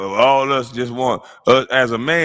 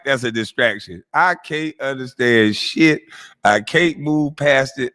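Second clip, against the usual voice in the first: normal talking voice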